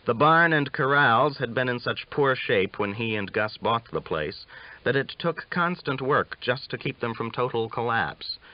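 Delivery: ordinary delivery, nothing marked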